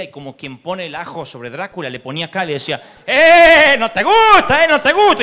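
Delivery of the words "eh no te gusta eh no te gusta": high, loud mock rebuke; deacon rebuking demon. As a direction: taunt the devil with the Bible. (diácono increpando al demonio a gritos)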